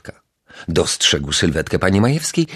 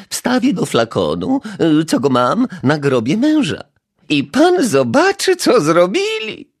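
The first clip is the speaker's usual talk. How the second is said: high, louder complaining neighbour; aggrieved old lady; 11 semitones higher, 4 dB louder than usual. A narrator's reading. Neighbour complains to the detective about her flowers, high aggrieved voice. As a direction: list her grievances, hurt and cross.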